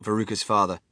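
usual speaking voice

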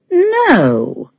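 high, wounded little no; hurt. Acted. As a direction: let the hurt show in one word. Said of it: "said Leah in a hurt voice".

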